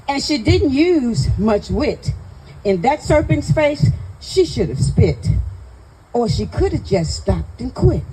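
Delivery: spoken-word rant in rhyme; poem recitation. Acted. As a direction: recite it as exasperated comic verse, landing each rhyme. Recites own comic poem.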